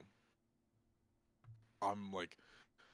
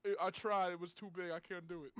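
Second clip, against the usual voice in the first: mock drunk guy; 8 semitones higher, 4 dB louder than usual.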